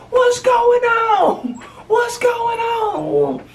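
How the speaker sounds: very high, slow wailing shout; imitating frantic mother. Friend's mother running outside, high wailing mock shout. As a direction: wail it like a panicked mom.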